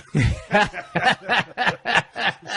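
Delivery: a big laugh at his own story; hearty laugh. Real, not acted. Wrestler laughing at his knockout story, big laugh. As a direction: let a full, high laugh roll out.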